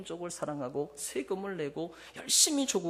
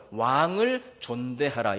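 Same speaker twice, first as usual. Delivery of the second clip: scripture reading; 8 dB louder, slower than usual. Acted. Reads verse slowly.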